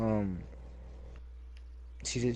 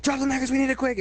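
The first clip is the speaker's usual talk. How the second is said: high, loud urgent hurry-up; hammed-up kitchen rush call; mock rushing shout; 12 semitones higher, 4 dB louder than usual. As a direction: bark orders to speed her up. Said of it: "I'm like".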